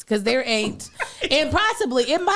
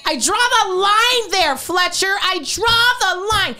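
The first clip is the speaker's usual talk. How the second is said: indignant mom rant; 9 semitones higher than usual. Acted. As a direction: lay down the law to your husband, pitched high. Acted mother's rant to her husband.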